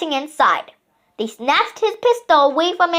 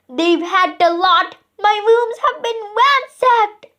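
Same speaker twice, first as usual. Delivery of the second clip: screaming; 5 semitones higher than usual. A narrator's reading. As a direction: wail over the stolen diamonds.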